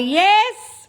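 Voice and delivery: excited yes. Real, not acted. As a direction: shout it like a win. Celebrating the hundredth party.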